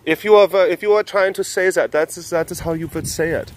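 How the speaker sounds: put-on high-pitched German-accented correction; mock German accent.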